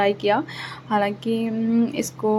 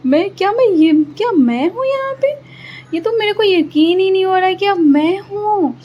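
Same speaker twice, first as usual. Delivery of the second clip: high, loud, amazed at her own face; stunned, high-pitched squeal of surprise; shocked disbelief; 9 semitones higher, 5 dB louder than usual. Real, not acted. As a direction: gasp at the mirror in disbelief. Shocked at her own ghost makeup.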